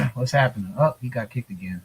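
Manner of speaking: ordinary talk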